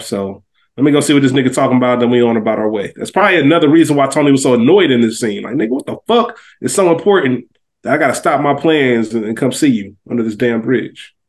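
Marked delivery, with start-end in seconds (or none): none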